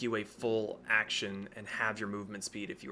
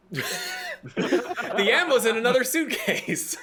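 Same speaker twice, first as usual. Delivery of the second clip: high, loud, slow laughing line; 13 semitones higher, 10 dB louder, much slower than usual. Real, not acted. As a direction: laugh it out slowly. Laughing joke.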